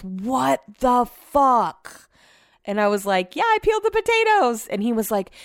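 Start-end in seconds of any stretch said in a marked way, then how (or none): 0.0-2.1 slow, drawn-out mock exasperation; retold disbelief. Retells partner's shocked reaction at fridge. 3.4-4.7 high-pitched, pleased announcement; retold proud reveal. Retells proudly revealing her surprise.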